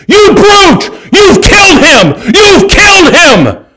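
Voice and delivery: very high, loud, drawn-out hysterical accusation; hammed-up shrieking outrage; outraged shout. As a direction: scream it like a horrified doctor chasing the culprit. Outraged doctor chases the healer.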